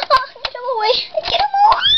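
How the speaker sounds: high panicked shriek; scared shriek. Pretend. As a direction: scream it, terrified. Pet terrified of tiger toy.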